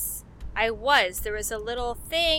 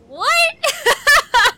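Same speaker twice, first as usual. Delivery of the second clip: high, loud startled laugh; surprised laugh; 13 semitones higher, 13 dB louder than usual. Real, not acted. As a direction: blurt it out in disbelief. Surprised by spell name.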